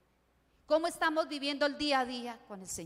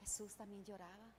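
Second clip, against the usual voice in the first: much softer and lower, almost breathed; hushed; 5 semitones lower, 18 dB quieter than usual. Real, not acted. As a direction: let the words sink to a near hush. Preacher on Jesus weeping, hushed.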